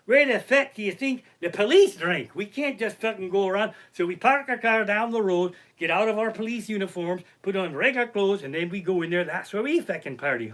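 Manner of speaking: put-on high, loud Irish accent, easing toward the end; put-on Irish cop voice; Irish policeman. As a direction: let them in on it as an Irish cop. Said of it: "the Irish cops are".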